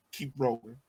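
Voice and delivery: normal talking voice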